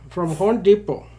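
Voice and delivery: put-on Indian accent. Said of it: "I don't know why I sound Indian".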